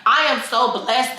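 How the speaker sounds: ordinary delivery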